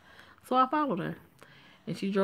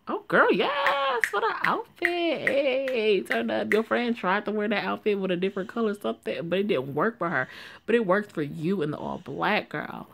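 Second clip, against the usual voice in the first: sassy friend voice; 5 semitones higher, 3 dB louder than usual. Acted. Friend hyping up Joanne's hospital outfit, high sassy gush. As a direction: squeal over the outfit like a hyped bestie.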